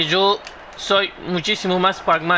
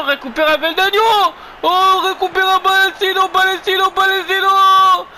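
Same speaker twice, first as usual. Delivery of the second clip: excited football commentator voice; live play-by-play; 4 semitones higher than usual. Acted. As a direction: call the match as it happens. Live commentary of his match.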